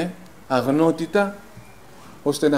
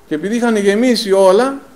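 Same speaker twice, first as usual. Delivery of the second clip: ordinary delivery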